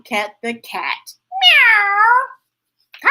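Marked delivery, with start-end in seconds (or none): 1.4-3.1 high, loud cat meow; squeaky playful meow. Acted. Voicing pets in story.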